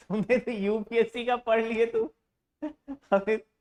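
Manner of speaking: real amused mock amazement; amused teasing. Real, not acted. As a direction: tease the student, breaking into a laugh. Teasing student, laughter follows.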